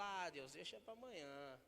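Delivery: soft, dragging, lazy brush-off; lazy reply. Retold or imitated. Preacher retelling his lazy answer to God, soft. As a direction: brush God off half-asleep. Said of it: "eu falo".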